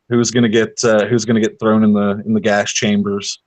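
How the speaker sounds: usual speaking voice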